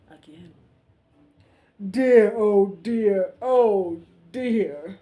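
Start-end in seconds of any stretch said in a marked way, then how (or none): 2.0-5.0 loud, slow weeping king; hammed-up crying. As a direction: wail each dear. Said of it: "he cries".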